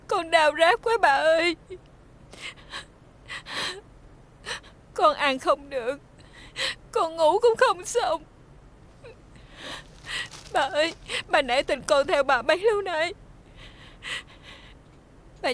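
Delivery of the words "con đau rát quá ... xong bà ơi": a touch of tears, pleading, slowing down; tearful pleading. Acted. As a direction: beg through mild tears. Injured woman begging, tearful.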